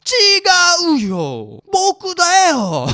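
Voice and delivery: loud, slow, high protest; indignant outcry. Real, not acted. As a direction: shout back, offended and amused. Protests the credit went elsewhere.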